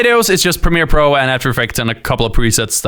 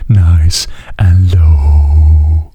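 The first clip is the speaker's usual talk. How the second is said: dropping to a very low, loud voice; low and slow, showing off range; deep voice; 7 semitones lower, 4 dB louder, slower than usual. Shown as a demonstration. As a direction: push the voice as deep as it goes. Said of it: "let me just try my hardest to make it sound really nice and low".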